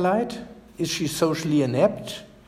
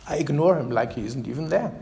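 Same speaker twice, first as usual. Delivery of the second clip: usual speaking voice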